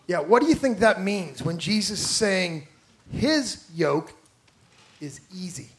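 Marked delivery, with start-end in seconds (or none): none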